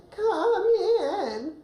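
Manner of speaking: put-on weak old grandma calling out, pitched very high and thin. Shown as a demonstration. Coach demonstrating grandma's high voice for the actors. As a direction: pitch it way up like a frail granny answering the door. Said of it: "a high-pitched voice".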